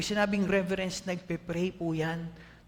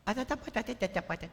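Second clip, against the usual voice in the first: put-on high, soft toddler babble; baby talk; 4 semitones higher, 4 dB quieter than usual. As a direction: mimic a little child's baby talk. (ginagaya ang bulol na bata)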